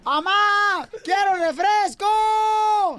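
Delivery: put-on high, drawn-out kid's holler; imitated child yelling, each word stretched long. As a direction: bawl for Mom like a demanding little boy. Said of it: "empieza a gritar el niño".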